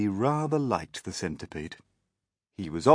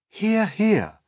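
high, raised cheer; approving Ladybird; 8 dB louder than usual. A narrator's reading. Ladybird supports the grasshopper, approving. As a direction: back the speaker up loudly.

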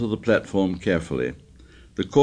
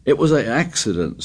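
suddenly; 7 semitones higher than usual. A narrator's reading. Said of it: "said Piggy suddenly".

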